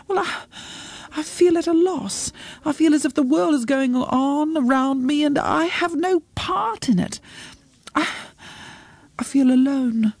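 high, plaintive, halting voice; forlorn confession. Acted. Mother confesses feeling lost and alone.

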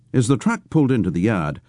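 ordinary delivery

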